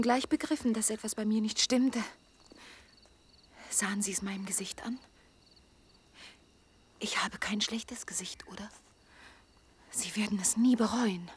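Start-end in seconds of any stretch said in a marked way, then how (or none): 3.7-11.3 whispered, soft and intimate; a secret breathed to him; hushed whisper. Acted. Intimate plea to a man. (geflüstert, leise und vertraulich)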